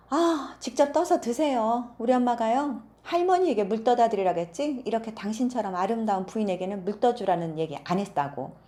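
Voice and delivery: loud, high rude snap; bratty daughter talks back; rude retort. Acted. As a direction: brush off the lady rudely. Rude daughter snaps at lady.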